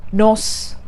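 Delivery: NOS with short open O and hissed S; "nos", ending in S instead of Z; mispronunciation. Shown as a demonstration. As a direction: say "nos" to rhyme with "boss". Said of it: "As long as you don't say".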